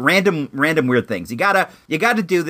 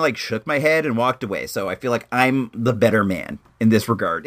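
ordinary delivery

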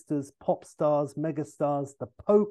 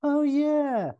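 Austin; 13 semitones higher, 6 dB louder than usual. Retold or imitated. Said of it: "And Austin's like".

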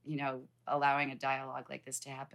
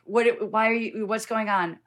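louder, higher startled questions; alarmed onlooker; 6 semitones higher, 10 dB louder than usual. Pretend. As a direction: jump in alarmed at the tears.

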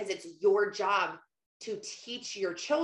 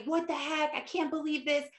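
lightly acted indignant venting, higher; angry husband; 6 semitones higher than usual. Husband reacting to the call about his son, mock angry. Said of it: "He's angry".

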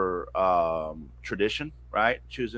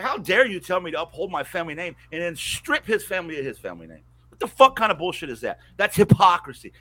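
retold anger; 6 semitones higher, 4 dB louder than usual. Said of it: "Then I was mad".

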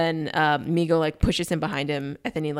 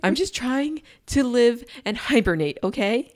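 higher, slow exasperated whine; exasperated bear; 6 semitones higher, slower than usual. Retold or imitated. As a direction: plead for hibernation, fed up. Mama bear complaining.

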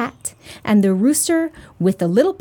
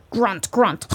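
pig grunts with a snort; grunting pig; 2 semitones lower than usual. A narrator's reading. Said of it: "answered the pig".

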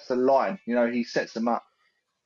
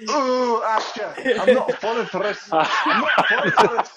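high mock-Scottish protest, breaking into laughter; exasperated Scottish woman impression, higher pitched; Angie; 7 semitones higher, 3 dB louder than usual. Retelling Angie refusing to fall for it, laughing Scottish accent. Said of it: "I can't do it Scottish, but she's like".

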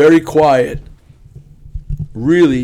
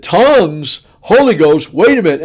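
loud, raised mock astonishment; alarmed disbelief; 4 semitones higher, 7 dB louder than usual. Counsellor reacting to talk of tongues, loudly taken aback. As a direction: blurt each phrase in comic alarm.

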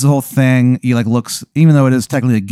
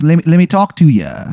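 higher-pitched bad English punk; unconvincing English accent; 5 semitones higher, 3 dB louder than usual. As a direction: plead in a put-on English accent. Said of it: "his accent isn't convincing at all".

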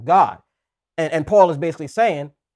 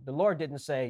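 ordinary delivery

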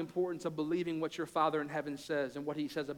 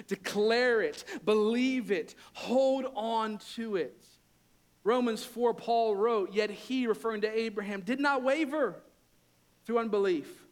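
much higher and louder, fervent and driving; preaching at full force; 5 semitones higher, 5 dB louder, slower than usual. Acted. Pastor urging the congregation to hold on, fervent loud preaching. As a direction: preach it with fire, declaring each promise.

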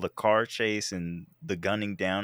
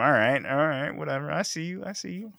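mock grudge; 7 semitones higher than usual. Mock threat to Marvel over snub.

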